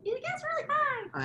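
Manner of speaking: a squeaky whiny pitch to tease him; mock whiny high voice. Imitating his whiny high voice.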